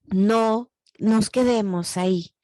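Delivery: normal talking voice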